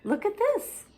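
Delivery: very high showing-off voice; big sister tricking little sister; sing-song wonder. Acted. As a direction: dazzle her with it. Zelda shows fairy dust.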